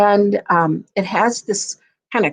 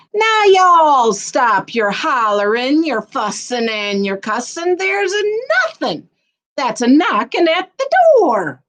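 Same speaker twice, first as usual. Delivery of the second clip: hammed-up fatherly telling-off; Pa scolding; 6 semitones higher than usual. Pa scolds the noisy family, hammed-up.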